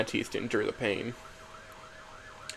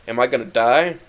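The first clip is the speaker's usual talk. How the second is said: louder, alarmed question; acted panic; 11 dB louder than usual. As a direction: cry out as the fear hits you. Dying narrator panics at realization.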